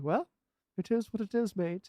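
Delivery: put-on a so-so Australian accent; Australian accent impression. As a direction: say it like a laid-back Aussie, not quite landing. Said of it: "It's just my impression".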